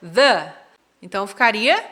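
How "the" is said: "The" with a short uh vowel; "The" as thuh. Shown as a demonstration. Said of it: "quando tem som de consoante".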